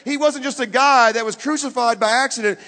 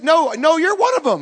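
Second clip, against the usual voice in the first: high, loud contradiction; insist, pitched right up; acted insistent accusation; 7 semitones higher, 7 dB louder than usual. Crowd insisting to the disciple, high and loud.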